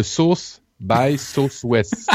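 slow mock French-accented English, "South" said as "Sauce"; drawn-out "Sauce by Southwest" in mock French-accented English; Guillaume's English accent. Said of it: "de rire de l'accent de Guillaume".

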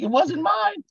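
very high squeaky retold protest; high-pitched "not me" impression; mock defensive denial. Acts out brother's hasty denial.

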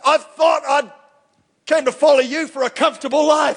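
mock complaint. Preacher playing a believer complaining to Jesus, mock whine. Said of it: "I'm like".

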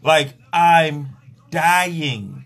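very slow, dragging out each word; drawn-out exhausted groan. Acted. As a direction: sound totally spent. Exhausted mid-sex.

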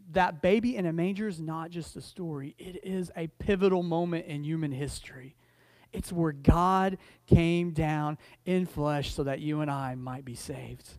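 hushed, earnest preaching. Real, not acted. Preacher on the manger's meaning, soft and earnest. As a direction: let it sink in gently, quiet and low. Preacher's solemn closing point.

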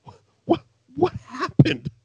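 high, bewildered question; baffled. Retold or imitated. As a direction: sound totally lost. Continues baffled reaction.

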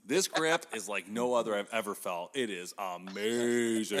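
slow, drawn-out AMAZING at the end; mock testimonial gush. Shown as a demonstration. Host rehearsing a product plug for the bow grip, mock gushing. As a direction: oversell it, stretching "amazing" out long.

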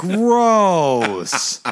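high, loud grossed-out groan; disgusted. Real, not acted. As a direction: recoil in disgust. Grossed out by the topic.

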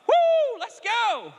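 high, loud whoop of joy; shout of praise. Shown as a demonstration. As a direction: whoop it out like a cheering fan. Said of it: "It's shouting".

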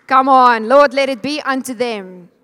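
loud, emphatic exhortation; fervent preacher's shout of prayer; rallying cry. Real, not acted. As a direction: call out boldly to stir the congregation.

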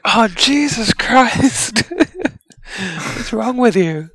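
high, slow and appalled; disgusted character voice. Acted. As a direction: recoil at something gross, drawn out. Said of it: "just like".

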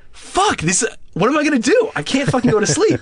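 high, panicky, near squeal; panicked. Retold or imitated. Too scared to sleep in the hotel, high panicky voice. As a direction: let the fear push the voice way up. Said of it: "I'm like".

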